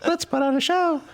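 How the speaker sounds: high bright excited voice; excited quote. Retold or imitated. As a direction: say it like a big idea. Friend Lisa quoted enthusiastically.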